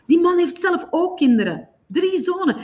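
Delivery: high-pitched, appalled objection; indignant protest. A narrator's reading. Jamie's indignant reaction to the scene.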